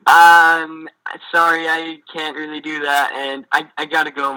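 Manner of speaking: awkward refusal. Retold or imitated. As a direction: squirm your way out politely.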